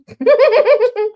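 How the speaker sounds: loud high cackle; high burst of laughter. Real, not acted. Host cracking up at own joke, laughing loudly. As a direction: break into a real high laugh.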